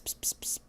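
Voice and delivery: unvoiced psst psst; quiet hissed psst for the cat; whispered psst. Shown as a demonstration. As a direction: demo the cat-calling psst. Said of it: "if you do the psst".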